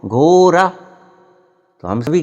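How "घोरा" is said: slow, loud, high घोरा with R for ḍ; teacher's mispronunciation. Acted. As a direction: stretch घोरा out for the class, R replacing ḍ. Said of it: "अच्छा बोलो". (Master का खिंचा, ऊँचा घोरा, ड़ की जगह र)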